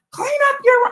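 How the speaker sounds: high, loud, sharp order; shrieking command to clean up; yelling at kid. Retold or imitated. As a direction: holler it like a fed-up mom. Mom yelling at son.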